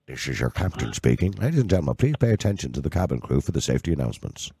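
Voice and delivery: low pilot PA voice; like a captain over the intercom; captain announcement. Retold or imitated. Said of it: "Dung, dung. The bell goes off".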